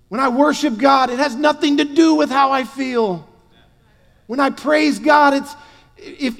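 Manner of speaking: high, loud fervent preaching; preaching at full force. Acted. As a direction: preach it with conviction.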